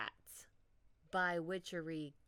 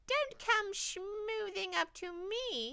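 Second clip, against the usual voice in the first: scolding witch; 13 semitones higher, 6 dB louder than usual. Acted.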